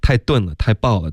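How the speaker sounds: ordinary talk, nothing marked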